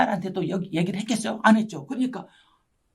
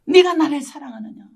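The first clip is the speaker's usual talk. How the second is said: higher, louder searching question; voice of Jesus calling; 5 semitones higher, 7 dB louder than usual. Retold or imitated. Preacher voicing Jesus confronting her, raised and louder. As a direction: call it out as the Lord confronting her. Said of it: "그래서 저한테 찾아오신 거예요".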